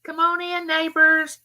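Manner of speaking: high, bright-eyed hello to the room; singsong welcome. Real, not acted. Host welcoming live chat neighbors, high singsong greeting. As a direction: sing them in warmly, voice lifted high.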